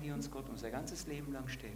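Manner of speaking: usual speaking voice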